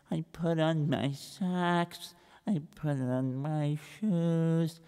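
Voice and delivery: soft, slow, dragging account; slow plodding recital. Acted. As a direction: drag out every boring detail. Reb Israel plods again.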